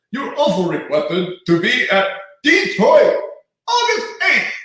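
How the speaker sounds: high, booming announcer voice rising to a shout; Howard Finkel impression. Announcer booking him on the answering machine, booming announcer voice. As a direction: read the booking like a big ring introduction. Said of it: "I'm doing the worst Howard Finkel".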